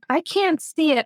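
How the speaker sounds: high-pitched, mildly pleading protest; retold despair. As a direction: quote your own despair, pitched well up. Retelling her despair to husband.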